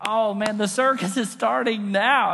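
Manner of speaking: loud, delighted mock spectator; excited crowd. Villagers thinking the circus is starting, loud excitement. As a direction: cheer like a thrilled circus audience. Villagers mistake warning for show.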